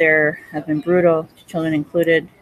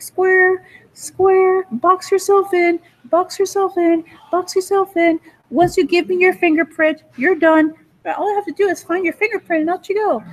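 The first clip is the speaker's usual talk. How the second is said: high put-on chanting jeer; mock taunting; 12 semitones higher than usual. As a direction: repeat it tauntingly, way up in pitch. Acted taunt, repeated jeer.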